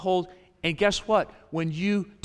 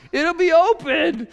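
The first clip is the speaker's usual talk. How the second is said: much higher and loud; excited; 10 semitones higher, 7 dB louder than usual. Real, not acted. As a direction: burst out with amazement. Excited preaching climax.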